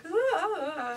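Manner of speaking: high, strained wail of a botched run; ridiculous failed vocal run. Retold or imitated. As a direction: overreach a diva run and crack it. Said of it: "it would just sound ridiculous".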